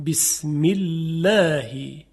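بسم الله slow, with the lam of الله light and thin after the i. Shown as a demonstration. Teacher models the thin lam after a kasra.

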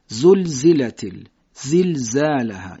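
"زلزالها" slowly, each ز buzzed; buzzing z. Shown as a demonstration. Teacher reciting Surah Zalzala to show the buzzing ز. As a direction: buzz through "زلزلت" and "زلزالها" slowly. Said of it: "این حالت".

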